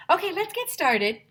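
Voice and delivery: high, loud puppet voice. Acted. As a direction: greet the class as a sock puppet. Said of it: "said Mister Bongo".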